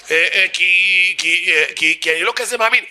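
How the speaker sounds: very slow, stuttering feeble whine; sick-chick voice. Acted. Cornered heckler excusing his lack of faith, sick-chick voice. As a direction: stammer it out, climbing at the end. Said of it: "קול של גוזל חולה עם בעיות רגשיות".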